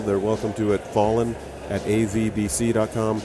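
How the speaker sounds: ordinary talk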